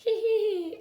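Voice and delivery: high cackling witch laugh; high evil cackle. A narrator's reading. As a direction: cackle with glee. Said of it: "she cackled".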